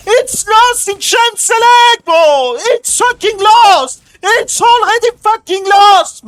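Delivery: high, loud, enraged yelling; furious shouting. Real, not acted. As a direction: scream that the game is already lost. Raging, game lost in champ select.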